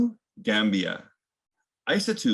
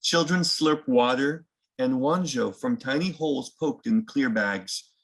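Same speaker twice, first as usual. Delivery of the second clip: usual speaking voice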